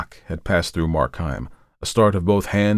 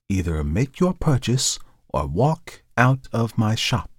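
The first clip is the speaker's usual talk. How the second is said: slow, measured, higher-pitched ultimatum; stern dealer; 4 semitones higher than usual. Acted. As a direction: order the customer out, deliberately.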